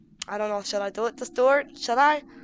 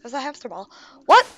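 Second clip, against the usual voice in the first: usual speaking voice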